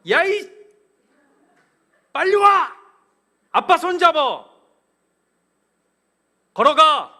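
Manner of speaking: high, loud furious commands; angry shouting dad. Retold or imitated. Dad yelling at his son to come along. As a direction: bark orders at a child.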